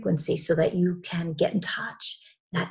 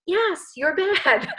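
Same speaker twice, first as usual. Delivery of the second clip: laughing tease; 10 semitones higher, slower than usual. Real, not acted. Teasing Erica.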